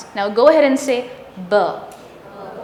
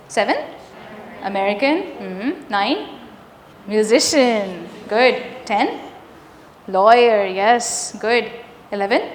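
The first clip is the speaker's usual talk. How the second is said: usual speaking voice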